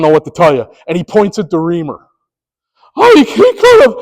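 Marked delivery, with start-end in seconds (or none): 3.0-3.9 pleading coach. Retold or imitated. Coach pleading that the other umpire could have seen it, high whine. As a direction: whine it while pointing.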